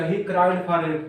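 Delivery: words drawn out one by one for copying; very slow, clear dictation pace. Shown as a demonstration. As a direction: dictate it slowly so students can write. Teacher dictating a sentence.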